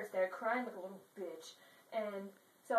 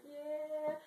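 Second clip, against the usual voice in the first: mock suave dancer yeah; 6 semitones higher than usual. Romeo dancing on Juliet.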